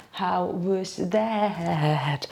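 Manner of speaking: very slow, stretched out; drawn out. Pretend. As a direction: stretch every word out. Drawn-out repeat.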